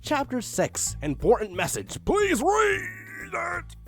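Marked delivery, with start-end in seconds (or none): none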